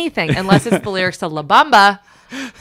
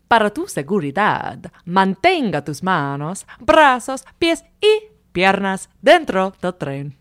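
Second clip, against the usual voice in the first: official Spanish ride announcement; Spanish accent; slower than usual. Shown as a demonstration. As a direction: announce it like a theme park ride. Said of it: "do you wanna hear it".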